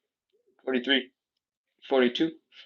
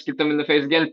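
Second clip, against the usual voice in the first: ordinary talk, nothing marked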